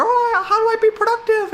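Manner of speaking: very high, loud whiny put-on voice; mock whiny viewer. Mocking TikTok addicts asking how to be productive, high and whiny. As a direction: whine it like a clueless scroller.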